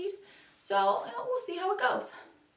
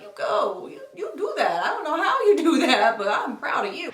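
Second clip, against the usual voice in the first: laughing, loud mock cheer; amused loud encouragement; laughing praise; 4 semitones higher, 8 dB louder than usual. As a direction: cheer them on through a laugh. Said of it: "I'm like".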